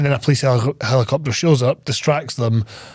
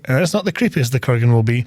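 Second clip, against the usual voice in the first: ordinary talk, nothing marked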